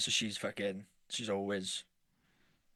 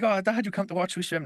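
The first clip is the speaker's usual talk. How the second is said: put-on higher, eager little-boy question; excited kid; 8 semitones higher than usual. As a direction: chirp it up like a keen young son. Son's question voiced at poolside.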